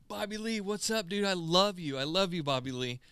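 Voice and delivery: high, eager fan calling out to a streamer; excited fan shout-out. Retold or imitated. Fan hyping up a streamer on TikTok live, high and eager. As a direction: gush at him like a starstruck fan.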